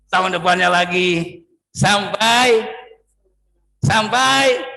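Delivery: building loud. Acted. Building delay list to climax.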